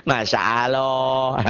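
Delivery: slow, stretched Sundanese "Masya Allah"; hammed-up Sukabumi "Masya Allah"; Garut-Sukabumi accent. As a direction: draw out "aaloh" like someone from Garut. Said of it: "orang Garut, orang Sukabumi".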